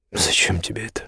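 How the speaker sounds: soft and nearly whispered; weary, hushed question. A narrator's reading. Exhausted count asks his meddling friend, wearily hushed. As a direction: ask it with little strength left.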